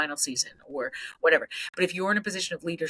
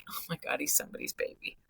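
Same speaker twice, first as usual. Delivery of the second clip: whispered, much softer; soft realization; 8 dB quieter than usual. Retold or imitated. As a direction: breathe it out as the calmed coach. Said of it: "If my lid is back on".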